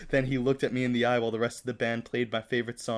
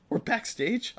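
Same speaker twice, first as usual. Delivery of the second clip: high-pitched, thrilled question; near scream; 11 semitones higher, slower than usual. A narrator's reading. Starstruck fan learning he is backstage, mock near scream. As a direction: squeak it like an overexcited fan. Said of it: "I almost screamed".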